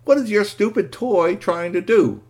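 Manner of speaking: high, loud, cross question; irritated demand. Acted. Injured boy asking his friend about the toy, irritated. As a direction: complain indignantly about the toy.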